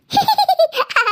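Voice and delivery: a high, cheeky giggle. Acted. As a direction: giggle at her sister's loss. Sister laughs at getting both. (കുസൃതിച്ചിരി)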